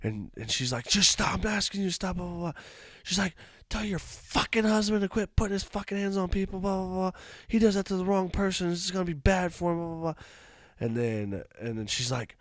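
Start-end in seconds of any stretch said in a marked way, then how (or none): 0.9-2.5 high-pitched, exasperated mock plea; imitated upset woman. Woman asking the wife to stop, imitated exasperation. Retold woman pleading in quarrel. 3.7-10.1 high-pitched rant at no extra volume; imitated angry woman. Woman warning the wife about her husband, imitated anger.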